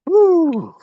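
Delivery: high, loud "woo"; loud cheering whoop that falls at the end; excited whoop. Real, not acted. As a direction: let out a big high whoop mid-workout. Coach whoops during the run.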